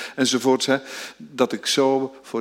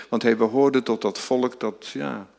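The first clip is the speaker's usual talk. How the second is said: ordinary delivery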